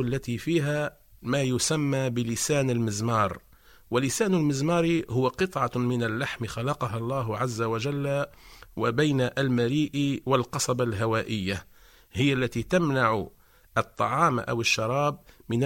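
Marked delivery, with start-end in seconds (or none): none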